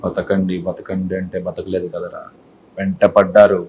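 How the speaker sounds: low, slow menace building in pitch and force; controlled anger. Shown as a demonstration. Actor demos anger with a film dialogue, low simmering anger building. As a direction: start low and deliberate, build to an angry finish. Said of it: "కోపం".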